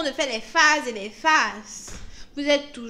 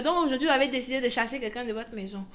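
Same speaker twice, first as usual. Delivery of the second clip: ordinary talk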